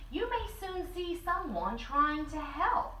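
plain reading voice

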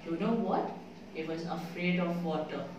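usual speaking voice

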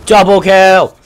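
loud, high game announcer call; mock announcer. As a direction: boom it like an arcade voice. Announcer-style game callout.

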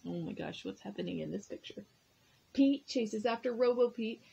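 ordinary delivery

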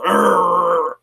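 loud, long growling grunt; imitated grunt. Recluse answering the friendly neighbour, imitated gruff grunt. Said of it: "the guy just grunted at me".

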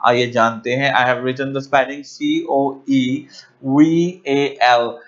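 ordinary talk

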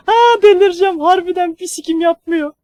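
high, loud, laughing disbelief; shrill laughing exasperation. Real, not acted. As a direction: laugh it out, near losing it. Gülüp çıldırma, tiz. (yüksek sesle gülerek çıldırmış gibi)

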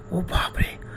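breathless whispered gasp; frightened gasp. Acted. Narrator recoils at the ghost voice, whispered gasp. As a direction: gasp it in terror. Hand rises again.

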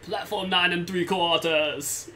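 slow, laughing mock Austrian accent; lightly voiced Arnold Schwarzenegger; Arnold Schwarzenegger impression. Friend jokes over co-op game, mock Arnold Schwarzenegger voice. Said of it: "Thanks, Arnold".

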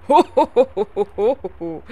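big loud belly laugh fading away; hearty ho ho laugh. Acted. Innkeeper Leos laughs at hungry Wilma, booming then fading. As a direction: boom out a jolly innkeeper's laugh.

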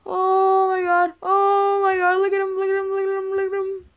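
alarmed pointing out; frightened. Pretend. Pointing at the monster.

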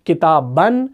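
کتابن ending in "-ban", rising. Shown as a demonstration. Teacher reciting the second case, -an ending on کتابن. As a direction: say کتابن as kitaban, short a before the n. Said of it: "تینوں حالتیں کون سی ہیں؟".

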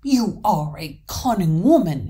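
slow, loud admiration from a troll; admiring troll, drawn out. A narrator's reading. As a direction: savour the compliment, loud and slow. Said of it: "answered the troll admiringly".

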